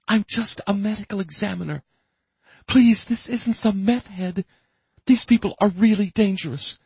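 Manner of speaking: high, frightened woman's voice; pleading woman. Acted. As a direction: beg him to stay put. Robin pleads with Max.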